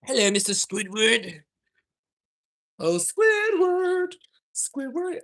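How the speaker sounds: high, loud, slow cartoon puppet voice; hammed-up kids' puppet voice stretching the name. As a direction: call out like a cartoon puppet greeting a friend. Shows son's puppet play.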